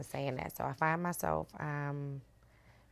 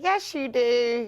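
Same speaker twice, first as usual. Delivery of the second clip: son insisting; 8 semitones higher, 8 dB louder, slower than usual. Retold or imitated. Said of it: "when he'd be like".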